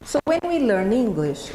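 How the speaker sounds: usual speaking voice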